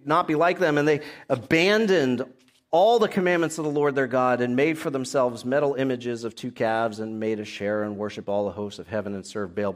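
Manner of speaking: usual speaking voice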